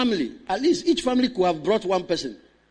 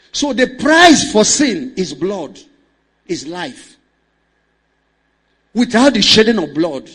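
loud, driving pulpit delivery; forceful preaching; 3 semitones higher, 8 dB louder than usual. Acted. Pastor preaching to the congregation, forceful preaching. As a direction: preach it hard to the church.

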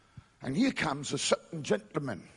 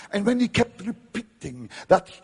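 ordinary delivery

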